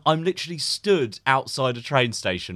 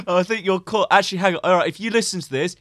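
higher, louder fumbling caller; flustered caller voice; 6 semitones higher, 4 dB louder than usual. Retold or imitated. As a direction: voice a caller who loses track.

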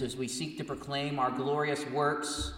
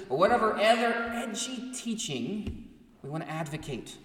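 higher and louder, urgent sermon; fervent preaching; 6 semitones higher, 5 dB louder than usual. Acted. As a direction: preach it hard against the modern church. Preaching rises to full force.

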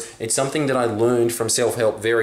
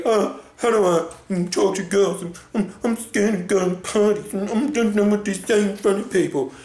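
mocking whiny loser; 6 semitones higher than usual. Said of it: "losers that are always saying to me".

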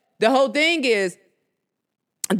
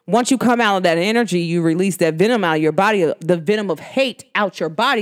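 ordinary talk, nothing marked

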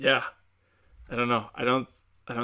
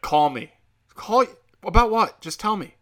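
acted quarrel; 9 semitones higher, 6 dB louder than usual.

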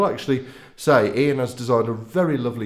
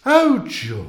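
sarcastic; 12 semitones higher, 5 dB louder than usual. Real, not acted. Sarcastic reaction to podcast delivery.

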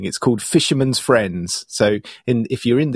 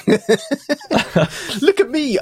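high, drawn-out mock boast; playful high-pitched imitation; Doug; 14 semitones higher, much slower than usual. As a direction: show off in a teasing put-on voice. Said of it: "I can now be like Doug".

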